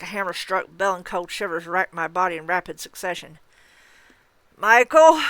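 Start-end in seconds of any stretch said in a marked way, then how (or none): 4.6-5.2 loud high strained call; wheezing shout. Acted. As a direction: call out with what breath is left. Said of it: "I wheezed as loudly as I could".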